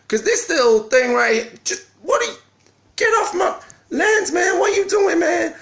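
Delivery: very high squeaky shout at a speck, slowed; mock shout at speck. Talking to a speck on the camera lens, a squeaky cartoon shout. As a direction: shoo a tiny intruder off your lands in a squeaky cartoon yell.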